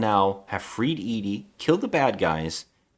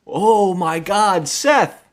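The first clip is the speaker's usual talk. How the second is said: much higher, louder, drawn-out mock panic; sudden realization; 8 semitones higher, 7 dB louder, much slower than usual. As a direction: overact the gasp of remembering a friend. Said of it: "they all stop the car and they go".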